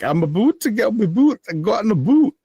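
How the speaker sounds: high, loud, fast mock Canadian accent; hammed-up Canadian vowels, boot for boat; imitating friend's Canadian accent. Friend from Canada teasing about her boat, in a mock Canadian accent. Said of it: "she emphasizes it so much now".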